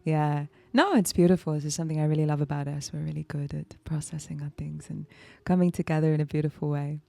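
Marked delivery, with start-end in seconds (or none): 2.9-5.1 hushed whisper of affection. Real, not acted. Telling her partner softly, hushed whisper. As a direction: breathe it softly, just for us. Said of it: "whispered affection".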